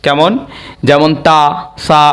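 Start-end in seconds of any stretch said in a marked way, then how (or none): none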